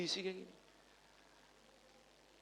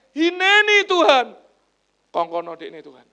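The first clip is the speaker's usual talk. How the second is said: high, loud, slow "Hineni Tuhan" stretched out in a mock eager shout; 9 semitones higher, 20 dB louder, slower than usual. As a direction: ham up "Hineni Tuhan" like a showy volunteer. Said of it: "Kita sukanya". ("Hineni Tuhan" diucapkan tinggi, keras dan lambat, mengejek)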